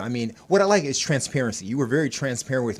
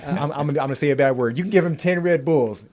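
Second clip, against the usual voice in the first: normal talking voice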